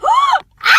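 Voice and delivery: excited scream. Real, not acted. Friend reacts to the romantic plea, high squeal. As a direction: gasp into a high, loud squeal.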